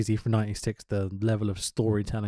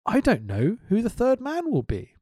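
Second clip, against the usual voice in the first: quoting Heenan; 10 semitones higher than usual. Retold or imitated. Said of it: "Heenan says".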